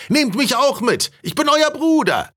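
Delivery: very high-pitched heckling call; calling from crowd. A narrator's reading. Spectator begs the passing duke to take him along, high call. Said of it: "rief jemand aus dem Publikum".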